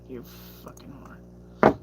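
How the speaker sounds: breathy whispered curse under breath. Real, not acted. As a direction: whisper it to yourself. Muttered insult while reading chat.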